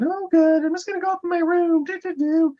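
high, chirpy put-on girl voice; breezy girly trill, off to her room; Tammy. Voices happy Tammy answering parents.

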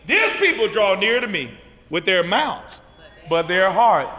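loud, low scripture citation; loud scripture quote. Acted. Preacher quoting scripture to the congregation, loud. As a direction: declare the verse.

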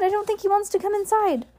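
very high, rising panic; alarmed play-by-play. Acted. Heidi watching Bruce pull away, in alarm. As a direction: let dismay climb to a squeal.

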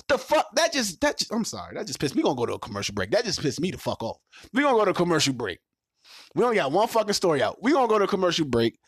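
so pissed off he has to cut to a break; angry outburst. Real, not acted. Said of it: "maybe I'll be calm down".

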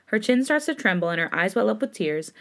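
usual speaking voice

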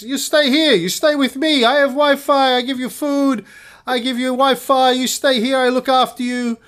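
put-on high, loud Czech-accented old man. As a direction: boom it out like a bossy Czech host. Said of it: "he had an accent".

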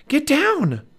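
high loud urgent warning; urgent cry. Acted. Annie yells to Jack as the creature swoops. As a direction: yell a warning to duck. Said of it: "cried Annie".

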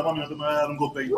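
ordinary delivery

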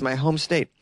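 ordinary talk, nothing marked

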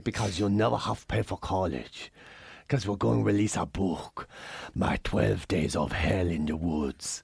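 very low, soft, drawn-out mock ninja; movie ninja voice. Dad reveals the book scheme to his kid, as a movie ninja. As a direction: put on a hushed, deep movie-ninja voice. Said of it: "I'm just copying a ninja I seen in a movie once".

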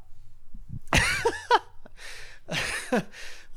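long, high-pitched helpless laughter; high squealing laugh. Real, not acted. [name] howls at his co-host's mock speech, squealing laughter.

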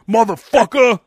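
drawn-out mock tough "Motherfucker"; tough-guy motherfucker. Mimicking how tough guys say "Motherfucker". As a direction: sneer "Motherfucker" like a thug. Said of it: "says motherfucker like that".

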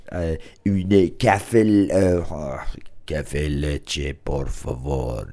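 slow, lightly put-on French accent ordering coffee; a hammed-up French accent on café order; mock French accent. Ordering coffee in Spain, in a mock French accent. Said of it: "I'm doing it in French accent".

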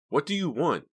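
high, loud cry; shrill cry. A narrator's reading. Dina to the caller, shrill frightened cry. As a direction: cry out sharply in fear. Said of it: "Dina cried shrilly".

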